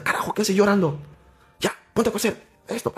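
scolding mother. Retold or imitated. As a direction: play his mom telling him off. Mother voiced scolding son. (imitando a la mamá que regaña, mucho más aguda)

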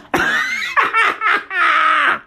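high, rapid cackling laugh; big laugh. Real, not acted. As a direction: burst out laughing at your own bit. Laughs at own impression.

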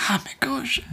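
high, soft dismay of a Scot; appalled Scottish listener. Acted. Imagining Scottish listeners reacting, high soft dismay. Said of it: "anyone Scottish listening to this is like".

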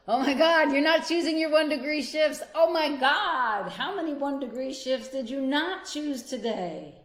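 loud, high, hammed-up exasperation; mock scolding. Coach scolding listeners for skipped shifts in loud mock dismay.

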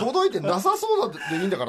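high, laughing mock protest; frustrated listener. Listener objecting to the hosts' choice, mock exasperation. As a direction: argue back in playful exasperation. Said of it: "すごいモヤモヤしながら聞いてましたね".

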